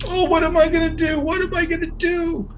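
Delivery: very high, wailing cry. A narrator's reading. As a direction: wail it out in tears. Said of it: "Wilbur started crying".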